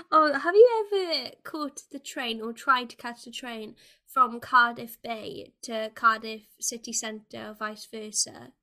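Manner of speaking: usual speaking voice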